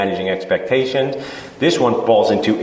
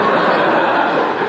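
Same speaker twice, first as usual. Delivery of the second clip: a quick airy laugh at his own joke; short breathy laugh. Real, not acted. Dentist laughing at his own sarcastic quip, short airy laugh. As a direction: let a little laugh slip out.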